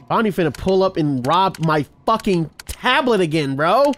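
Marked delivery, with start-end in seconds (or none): none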